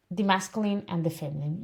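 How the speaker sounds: normal talking voice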